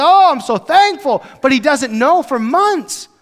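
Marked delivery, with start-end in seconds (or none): 0.0-1.2 much higher, louder and slower burst of joy; overjoyed Paul. Retold or imitated. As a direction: gush with relief. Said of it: "He'll say".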